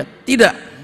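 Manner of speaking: flatly refuse, pushing back hard; stubborn refusal. Acted.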